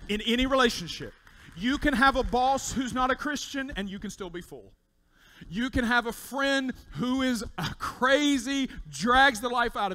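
loud, high, urgent pulpit call; preaching at full force. Acted. Pastor urging listeners about difficult bosses, loud preaching. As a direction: lift the room with every phrase.

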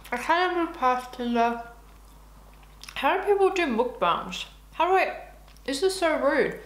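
talking through a mouthful; mouth full. Real, not acted. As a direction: mouth full while speaking. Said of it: "eating and talking with my mouth full".

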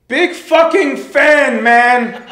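high, loud, slow starstruck fan; hammed-up shouting fan greeting a star; gushing fan. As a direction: bellow it like an overexcited superfan.

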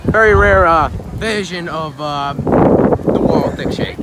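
loud, excited pitch of a rare find; hammed-up nature host. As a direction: announce it like a thrilled presenter.

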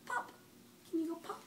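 ordinary delivery

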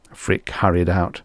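plain reading voice

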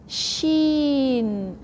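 sheen, said with a long sh sound; Arabic sheen. Shown as a demonstration. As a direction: say "Sheen." clearly as the Arabic letter. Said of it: "If you don't know, you say ash".

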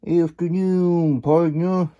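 high, slow put-on coyote voice; coyote drawl. Coyote greeting a sleepy cowboy, in a high slow drawl. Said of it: "said a coyote".

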